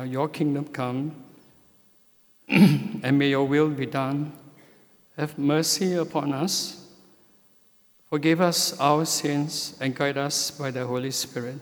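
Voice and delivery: ordinary talk, nothing marked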